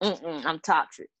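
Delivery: normal talking voice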